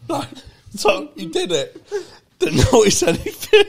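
high, tearful helpless laughter; crying with laughter. Real, not acted. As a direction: near tears from laughing. Laughing so hard near tears.

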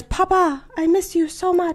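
high, pining put-on son's voice; mock child spirit. Medium faking a dead son's message, high mock-childlike voice. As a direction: plead sweetly like a fake child spirit. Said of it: "your son, he says".

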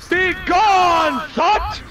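high, loud commanding bellow; booming shout. Acted. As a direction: banish them with a roar. Shouted ability line.

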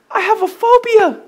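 very high, loud mock-panic squeal; panicked confession. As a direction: blurt it out as a hysterical cousin impersonation. Panicking cousin's outburst acted out.

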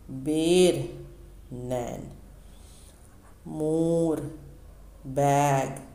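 each syllable called out loud and drawn out; loud syllable drill. Shown as a demonstration. Hindi teacher drilling children through the barakhadi, loud and deliberate. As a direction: chant them crisply for young pupils to copy. Reads practice word list aloud.

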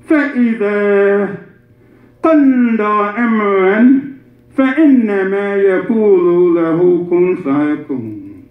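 fast, rhythmic Arabic chant; تلاوة القرآن; Quran recitation. Acted. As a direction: recite the verse as scripture.

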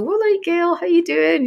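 high, loud chirpy mum greeting; mums' greeting. Retold or imitated. Mums greeting her in the shop, chirpy and high. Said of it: "the mums will just go".

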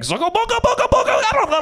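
high, loud nonsense French babble; gibberish mock French. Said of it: "I can't do an impression of it".

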